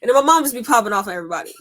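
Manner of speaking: ordinary delivery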